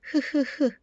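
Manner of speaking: 흐흐흐 as three separate puffs of H; breathed H sound. Shown as a demonstration. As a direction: puff out "흐흐흐" to show the H in house. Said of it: "house를 하게 되면".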